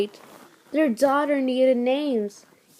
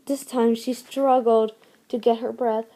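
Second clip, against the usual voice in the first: ordinary delivery, nothing marked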